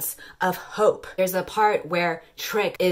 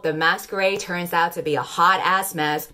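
ordinary delivery

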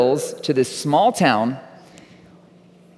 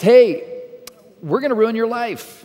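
higher, bright mock announcement from dad; dad's cheery announcement; 8 semitones higher than usual. Dad announces the move to his son, mock cheerful and much higher. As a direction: play dad breaking big news as if it's great. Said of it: "my dad announced".

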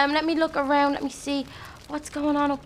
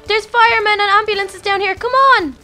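shouting; 5 semitones higher, 7 dB louder than usual. Acted. Calling people down to safety.

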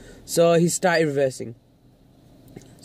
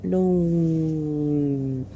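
drawn-out refusal. Retold or imitated. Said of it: "And I was like".

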